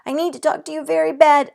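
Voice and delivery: high, loud and fast; shouting. A narrator's reading. Girl shouts at mother rocking the baby. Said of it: "I shouted some more".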